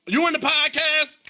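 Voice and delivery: loud, high imitation of a caller; mock caller's yell. Host teasing co-host about phoning with popcorn, mock yell. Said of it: "You called me throwing popcorn back like".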